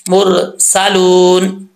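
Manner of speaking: मुरसालून with the long u stretched one count; slow recitation. Shown as a demonstration. Teacher reciting the word slowly for students. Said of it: "एक अलिफ की मिकदार लंबा करेंगे".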